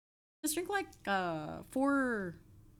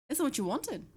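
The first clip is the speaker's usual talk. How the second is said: higher put-on voice; 6 semitones higher than usual. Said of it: "trying to do random voices".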